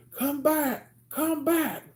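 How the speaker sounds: high mock yell, calling after someone; roommate yelling. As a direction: shout after him from down the street. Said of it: "but I hear".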